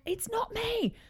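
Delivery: high, squeaky protest; exasperated protest. Retold or imitated. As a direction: squeal it in disbelief. She protests to dad.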